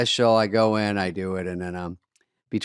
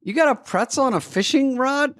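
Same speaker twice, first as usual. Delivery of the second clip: mock disbelief; 14 semitones higher than usual. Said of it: "And I'm like".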